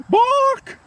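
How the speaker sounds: high sharp bark; dog bark. Acted. A dog character barks.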